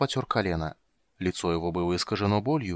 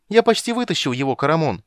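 high, loud gleeful call; joyful; 6 semitones higher, 7 dB louder than usual. Acted. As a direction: announce it happily. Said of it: "радостно сообщил он". (радостно)

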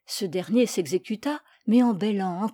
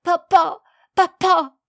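high, loud desperate cry; anguished child calling out; broken-voiced pleading; 8 semitones higher, 8 dB louder, slower than usual. A narrator's reading. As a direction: beg your father to stop. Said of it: "Il supplia d'une voix brisée".

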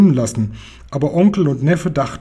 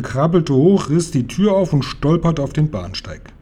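usual speaking voice

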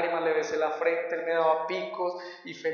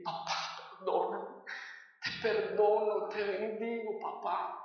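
soft, near-whispered plea; tender forgiveness; 3 semitones higher, 7 dB quieter than usual. Retold or imitated. Son forgiving his father at the prison, soft and moved. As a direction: breathe it out, moved to tears.

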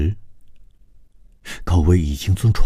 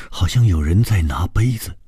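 ordinary delivery

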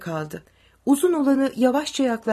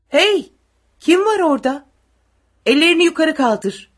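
high, loud soldier's challenge; loud armed command; challenging shout; 7 semitones higher, 8 dB louder than usual. A narrator's reading. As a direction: call out sharply to halt an intruder. Soldier challenges people he spots.

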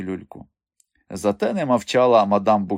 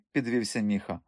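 ordinary delivery, nothing marked

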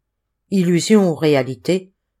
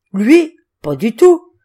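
higher, louder scoff; scoffing surprise; 5 semitones higher, 8 dB louder, slower than usual. A narrator's reading. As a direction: brush off the question, surprised. Roadman scoffs at question. (surprise moqueuse, plus haute et plus forte)

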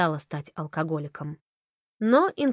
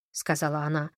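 usual speaking voice

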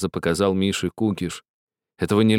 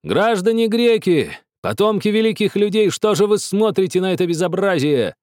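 shout; 9 semitones higher than usual. A narrator's reading. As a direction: call out to the whole courtyard. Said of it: "и крикнул".

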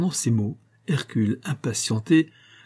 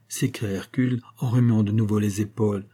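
ordinary delivery

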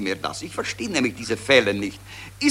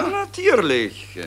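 slow and pitched up high, oozing false certainty; drawn-out agreement; 13 semitones higher, slower than usual. Acted. One gossip agrees with the other's war claim, slow and high. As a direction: stretch it as if it were obvious.

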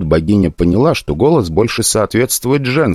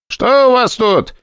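high, ringing, slow question; slower than usual. A narrator's reading. Brigade leader questioning the guards, high and ringing. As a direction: ring it out high. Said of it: "звонким и высоким голосом".

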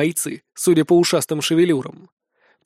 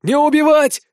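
high, loud order; urgent shout; 9 semitones higher, 5 dB louder than usual. A narrator's reading. Leader ordering his fighters to spare her, shouting. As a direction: cry out to halt them at once. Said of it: "кричу, спохватившись".

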